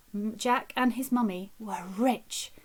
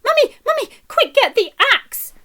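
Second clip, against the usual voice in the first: put-on much higher, loud urgent child's cry; frantic calling; 14 semitones higher, 10 dB louder than usual. A narrator's reading. Jack at the beanstalk bottom calling Mummy, frantic. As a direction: shout for Mummy in a panic.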